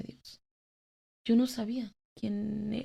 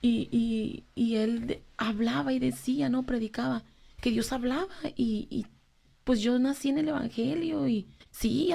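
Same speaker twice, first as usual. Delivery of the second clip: usual speaking voice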